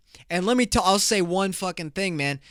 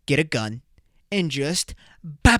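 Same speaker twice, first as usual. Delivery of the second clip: normal talking voice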